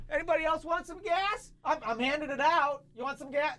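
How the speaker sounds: high, mock-cheerful giveaway call; high sarcastic call to people in line; sarcastic offer. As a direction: offer it round like a sarcastic host. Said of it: "and I'm just like".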